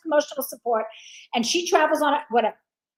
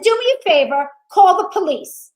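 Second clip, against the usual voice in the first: louder, insistent demand; raised voice; 4 semitones higher, 8 dB louder than usual. Retold or imitated. Demanding the manager call police, voice raised and louder. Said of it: "And now I start to raise my voice".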